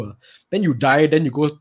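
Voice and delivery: ordinary talk, nothing marked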